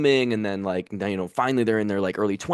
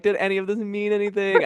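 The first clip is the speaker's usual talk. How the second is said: much higher, anguished mock; despairing question; 12 semitones higher than usual. Comedian voicing her end-of-tour doubt, higher. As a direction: cry it out. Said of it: "I'm like".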